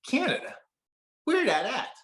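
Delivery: very high, baffled Southern US voice; puzzled Southern accent. Acted. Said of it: "attempt at my best Southern accent".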